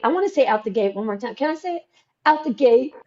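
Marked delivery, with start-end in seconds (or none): 2.2-2.9 Southern accent. Shown as a demonstration. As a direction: lean into the Southern accent on "Out the gate". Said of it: "My Southern accent will be here".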